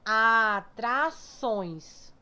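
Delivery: ATRAÇÕES drawn right out to find the strong syllable; long, linked, very slow ATRAÇÕES; stretched syllables. Shown as a demonstration. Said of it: "qual é o mais forte".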